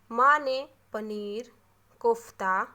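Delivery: slow list, a short pause after "paneer" and "kofta"; brief pauses. Shown as a demonstration. Teacher showing where a comma goes, pausing briefly after "paneer" and "kofta". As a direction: stretch "paneer", stop briefly, then land on "kofta". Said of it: "थोड़ी देर के लिए रुक रहे हैं".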